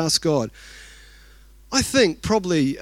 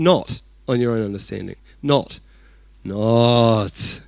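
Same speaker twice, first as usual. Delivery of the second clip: "Not" repeated, lower and firm; stress on not; 6 semitones lower than usual. Shown as a demonstration. Preacher drilling the word "Not" into the congregation, lowered and firm. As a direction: hammer home the word "Not".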